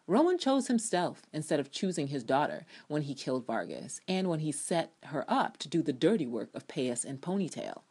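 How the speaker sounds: ordinary delivery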